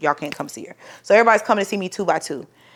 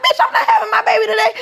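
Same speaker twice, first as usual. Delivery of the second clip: laughing shout of disbelief; 13 semitones higher, faster than usual. Retold or imitated. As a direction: holler it, half laughing, half panicking. Told she gives birth today.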